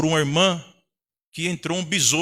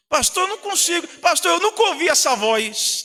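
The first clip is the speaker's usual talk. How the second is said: lightly voiced higher-pitched complaint; crente queixoso; 10 semitones higher than usual. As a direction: mimic a member pleading with the pastor. Voicing a complaining church member. (crente se queixando, levemente encenado)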